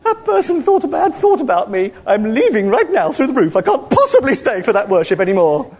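high, prissy, offended voice; a huffy spirit flouncing off; mocking a touchy Holy Spirit as a prissy vicar; simpering vicar. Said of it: "simpering, terrible English vicar".

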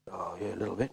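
very soft, low mumbled reply; sheepish admission. Retold or imitated. Man admits it to preacher, sheepish and hushed. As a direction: admit it under your breath.

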